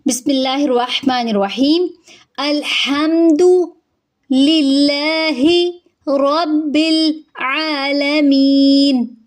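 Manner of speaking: slow, drawn-out melodic recitation of Arabic; Quranic recitation. Shown as a demonstration. Teacher starts reciting Fatiha for students, slow and melodic. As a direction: recite it slowly like in prayer. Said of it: "शुरू करते हैं।".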